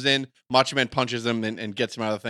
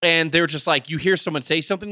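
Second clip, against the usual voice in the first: usual speaking voice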